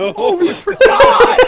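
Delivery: high, loud laughing squeal; squealing through laughter. Real, not acted.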